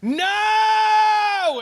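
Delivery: high shouted NO like a tantrum; hammed-up whiny shout; mock shouted no. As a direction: yell it like a spoiled brat. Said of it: "they're like".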